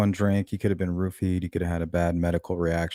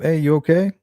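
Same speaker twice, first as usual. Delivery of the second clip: calling up in a higher voice, mildly raised; retold call-out; 8 semitones higher, 4 dB louder than usual. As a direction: imitate someone hollering up to check on a person. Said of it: "And he yelled up".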